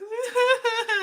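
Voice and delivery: high, drawn-out mock wail; exaggerated high-pitched crying noise; imitated bawling. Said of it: "and I'm bawling".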